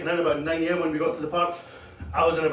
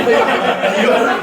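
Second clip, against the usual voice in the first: loud, slow, laughing out the question; mock-ask it through big laughter; 8 semitones higher, 11 dB louder, much slower than usual. Comic retelling strangers who never asked, laughing. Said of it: "without anybody saying".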